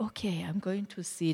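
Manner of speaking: whispering to herself; whispered thought. Retold or imitated. Thinking to herself in the park.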